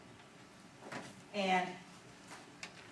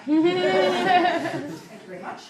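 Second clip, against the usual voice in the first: much higher, louder and very slow "Thank you very much"; smug thank you; 9 semitones higher, 12 dB louder, much slower than usual. Retold or imitated. Lawyer sitting his ass down after the win, drawn-out smug thanks. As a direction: drag out the thanks like a victory lap.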